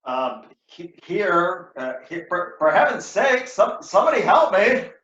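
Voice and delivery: halting groan building to a high, loud cry; wounded plea. Acted. Wounded Cassio on the ground begs for help, halting then loud. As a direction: stammer in pain, then cry out.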